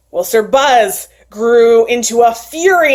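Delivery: usual speaking voice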